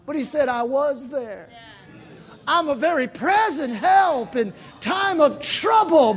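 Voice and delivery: loud, high preaching cry; preaching at full force. Acted. Preacher crying out to the congregation, full-force preaching. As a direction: preach it out, pleading with God.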